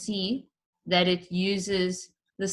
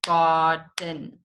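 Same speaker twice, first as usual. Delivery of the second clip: gar-den, slow and clapped; clapped syllables; 2 semitones lower than usual. Shown as a demonstration. Teacher clapping out two syllables slowly. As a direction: break garden into two beats. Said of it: "break it up with my claps".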